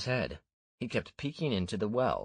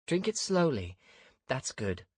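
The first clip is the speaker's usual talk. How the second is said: Ania's voice; 8 semitones higher than usual. A narrator's reading. Ania tends the weak kid.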